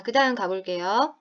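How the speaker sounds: ordinary delivery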